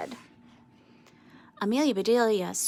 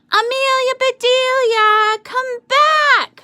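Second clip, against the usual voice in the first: high, loud, drawn-out call; shouting; 10 dB louder, slower than usual. A narrator's reading. Boys shouting after Amelia Bedelia running off, high and loud. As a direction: call her back from afar. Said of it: "shouted the boys".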